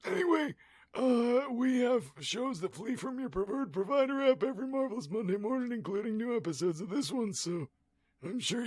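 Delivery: high, strained held-breath voice; stoned voice. Pretend. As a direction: talk while holding smoke in. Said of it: "this is my stoned voice".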